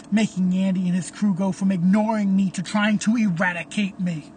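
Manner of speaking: ordinary delivery, nothing marked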